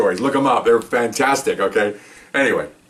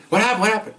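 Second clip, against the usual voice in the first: high, excited questions; agitated high-pitched query; urgent questioning; 10 semitones higher than usual. Retold or imitated. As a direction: re-enact cops rushing up wanting answers, voice pitched up. Arriving cops ask what happened.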